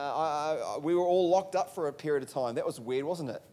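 usual speaking voice

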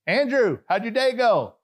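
high, loud call to a neighbour; acted chummy greeting. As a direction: hail him with put-on cheer. Acts greeting neighbour by name.

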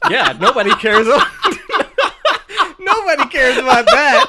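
high, loud words through real laughter; helpless laughter pushing the pitch way up; laughing hard. Real, not acted. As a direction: crack up while talking. Both hosts cracking up.

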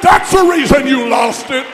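very high, very loud pulpit shout; full preaching shout. Real, not acted. Preacher rebuking backsliders, shouting at full force. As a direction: roar it at the church.

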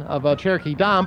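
ordinary talk, nothing marked

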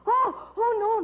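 panicked. Acted. Panic at dying man.

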